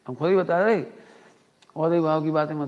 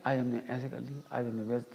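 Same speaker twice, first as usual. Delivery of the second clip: mock quiet mumbling; 5 semitones lower, 10 dB quieter than usual. Mocking listeners' complaints.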